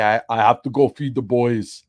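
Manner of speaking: a light mock impression of the viewer; imitating a viewer. Mimicking the viewer who left, lightly mocking. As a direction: tease him by copying his voice. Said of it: "that's exactly how he sounds".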